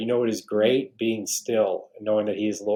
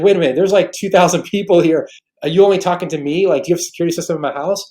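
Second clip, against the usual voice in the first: higher and louder, words tumbling out faster; amazed, half laughing at how exact it is; stunned disbelief; 6 semitones higher, 6 dB louder, faster than usual. Retold or imitated. Newly saved man reacting to the pastor in the crowd, stunned and loud.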